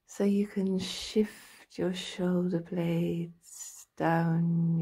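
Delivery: slow meditation voice with drawn-out words and long pauses; guided relaxation. Acted. Practitioner talking a listener into lying down and stretching, slow guided meditation. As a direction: guide them through the body slowly, stretching each phrase.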